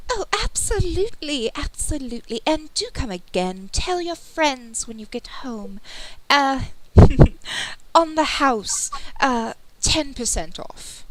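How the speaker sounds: high, fawning shopkeeper voice; over-eager merchant flattering a customer; gushing shopkeeper. Acted.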